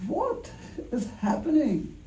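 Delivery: high, hushed, drawn-out disbelief; acted bewilderment. Passenger baffled by a stranger's remark, high soft bewilderment. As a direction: squeak out mock bafflement, haltingly.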